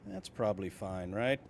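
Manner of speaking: ordinary talk, nothing marked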